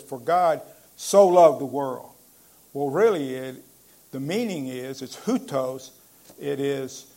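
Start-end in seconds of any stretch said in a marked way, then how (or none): 0.0-2.1 "so" leaned on, line louder; quoted verse, stressed so. Shown as a demonstration. Preacher quotes the King James verse with weight on "so". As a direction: proclaim "For God so loved the world" with weight on "so". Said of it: "it says".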